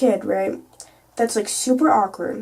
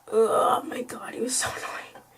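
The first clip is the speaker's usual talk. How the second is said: exasperated whisper; 5 dB quieter than usual. Real, not acted. Vlogger venting about the sub, exasperated whisper. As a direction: whisper it in disbelief, laughing a little.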